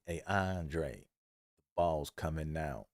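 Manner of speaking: soft, slow heads-up, laughing at the end; quiet tip-off. Retold or imitated. Teammate Derek quietly tipping Andre off, slow and soft. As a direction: murmur it like a secret warning. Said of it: "Derek was like".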